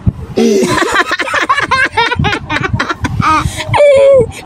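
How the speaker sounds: high crying laugh; shrieking laugh. Real, not acted. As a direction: laugh till it hurts. Laughing fit during challenge. (tawang parang umiiyak)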